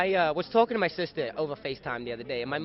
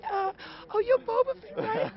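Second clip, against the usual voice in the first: much higher, gushing mock actress voice; mock Natalie Portman; 4 dB louder than usual. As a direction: gush like she spots a young star. Natalie recognises the boy actor.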